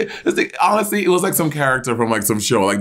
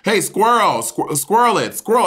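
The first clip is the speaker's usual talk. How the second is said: higher-pitched mock holler at a skier; pitched-up retold yell, little added volume; imitated yelling; 7 semitones higher than usual. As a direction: imitate an old man calling out. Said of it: "he's yelling at Bob".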